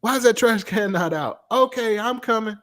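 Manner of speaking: father voicing the trash can argument; father and son exchange. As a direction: act out both sides. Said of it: "dialogue with son".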